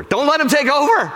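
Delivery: high-pitched forceful preacher's cry; exclaimed exhortation. Real, not acted. Preacher rallying congregation against giving up, forceful cry. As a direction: urge them hard to resist.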